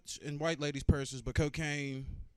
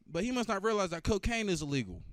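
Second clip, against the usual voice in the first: ordinary talk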